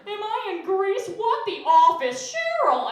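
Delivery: squealing, loud mock commercial lady; ditzy ad woman. Comic parodying a yogurt ad, loud mock squeal. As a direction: gush like a yogurt-ad woman in Greece.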